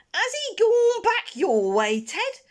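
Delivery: high, loud, slow call across the bushes. Acted. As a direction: shout to a brother out of sight. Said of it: "calling to each other".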